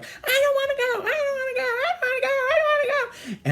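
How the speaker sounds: very high, loud repeated whining; mock child wailing. Child carried out by father, mock wailing. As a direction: play a kid bawling in a hammed-up tantrum.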